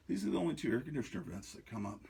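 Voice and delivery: ordinary delivery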